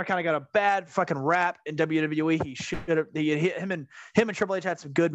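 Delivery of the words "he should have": ordinary talk, nothing marked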